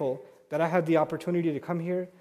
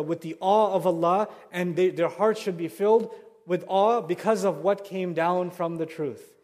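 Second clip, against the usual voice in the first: usual speaking voice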